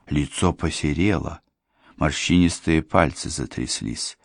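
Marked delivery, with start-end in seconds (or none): none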